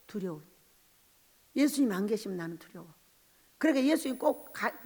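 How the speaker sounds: ordinary delivery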